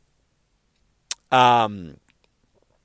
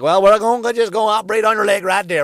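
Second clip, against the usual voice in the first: put-on Alabama accent; 8 semitones higher, 8 dB louder than usual. Alabama doctor about to operate, loud mock Southern accent. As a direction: play a surgeon with a hammed-up Alabama twang. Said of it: "someone with an Alabama accent".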